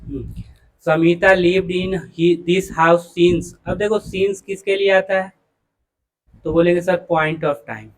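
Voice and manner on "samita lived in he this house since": very slow, word by word reading of the sentence. Shown as a demonstration. Teacher reading the next question aloud, very slow. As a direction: read it out slowly for the class.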